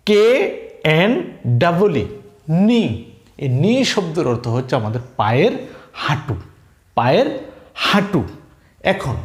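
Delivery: normal talking voice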